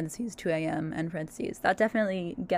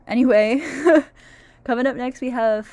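laughing; 5 semitones higher, 11 dB louder than usual. Real, not acted. Laughing loudly on air.

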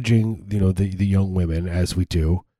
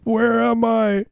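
very high, drawn-out mock plea; desperate pleading; 12 semitones higher, much slower than usual. Bewildered mascot out on the field, mock desperate wail. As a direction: stretch it out high like a lost mascot. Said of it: "comes out and pleads for help".

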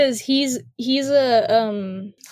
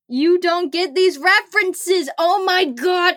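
high, slow, exasperated outburst; mock exasperated yell; 8 semitones higher, slower than usual. Metatron yelling at Castiel, a high exasperated mock outburst. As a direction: throw up your hands and yell it.

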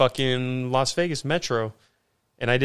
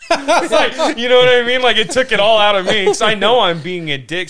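laughing; 7 semitones higher, 8 dB louder than usual. Real, not acted. Laughing while telling story.

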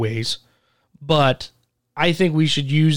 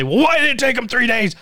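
high-pitched imitation of griping; mock complaining; 10 semitones higher than usual. People going to him first to complain, mock griping. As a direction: mimic the complainers, pitched right up. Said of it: "A lot of people are bitching".

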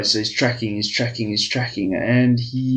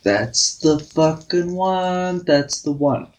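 excited hunter; 6 semitones higher, slower than usual. Acted. Hunter spots the dream bear.

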